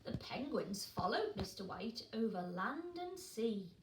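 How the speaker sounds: usual speaking voice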